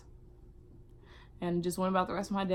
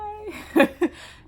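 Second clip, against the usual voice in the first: laugh; 6 dB louder than usual. Real, not acted. Laugh at own retold wail.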